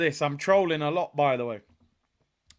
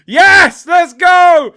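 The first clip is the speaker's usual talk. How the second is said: high, loud cheer; excited shout; 8 semitones higher, 14 dB louder, much slower than usual. Real, not acted. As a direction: punch the air and yell it. Cheering the goal.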